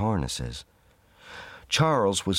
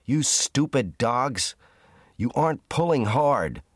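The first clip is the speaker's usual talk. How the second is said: angry shout; 5 semitones higher than usual. A narrator's reading. Hal yelling at dogs that can't move the sledge, furious. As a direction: berate the dogs with contempt. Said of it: "shouted Hal".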